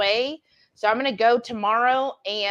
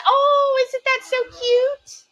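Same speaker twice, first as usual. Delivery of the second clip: cooing; 14 semitones higher, 4 dB louder, slower than usual. Real, not acted. Cooing over a pet that hops.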